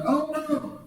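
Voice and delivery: high panicked voice, mock fear; frightened disciples. Preacher voicing the disciples panicking in the storm, high and scared. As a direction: squeak it out like scared fishermen. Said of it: "They was like".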